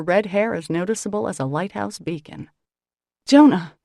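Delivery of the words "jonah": high, loud warning. A narrator's reading. Sister warning her brother, loud sharp warning. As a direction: cut him off with a warning. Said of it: "I warn".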